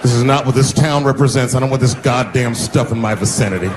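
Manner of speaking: disapprove like a proper citizen; upright townsman voice. Retold or imitated.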